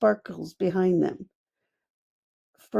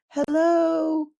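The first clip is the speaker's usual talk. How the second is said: hedgehog calling; 10 semitones higher than usual. A narrator's reading. Hedgehog calls from below.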